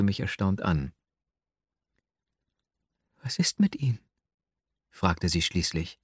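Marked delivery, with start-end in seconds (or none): 3.2-4.0 hushed astonished whisper. A narrator's reading. Young woman whispers her surprise to the narrator. She asks softly, astonished.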